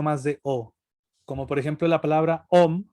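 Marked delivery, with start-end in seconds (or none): 2.5-2.8 "om" said with a closed o and humming m; the word "om", demonstrated; om demo. Shown as a demonstration. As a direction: sound out "om" as one syllable. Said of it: "en realidad se escribe aum".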